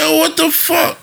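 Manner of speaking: high, drawn-out incredulous exclamation; mock disbelief. Reacting to a barber holding a nodding child, mock disbelief. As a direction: stretch it out high and baffled.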